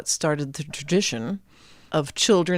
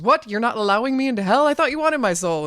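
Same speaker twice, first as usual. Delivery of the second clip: higher, fast disbelief; shocked Jack; 7 semitones higher, 3 dB louder, faster than usual. A narrator's reading. Jack turned away from hell, fast shocked high voice. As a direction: blurt it out stunned.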